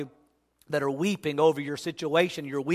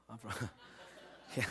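soft nervous chuckle trailing off; nervous laugh; 8 semitones lower, 22 dB quieter than usual. Acted. Peter laughing off a stranger's question, soft chuckle. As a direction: laugh the lie away under the breath.

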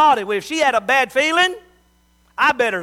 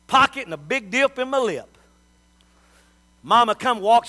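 usual speaking voice

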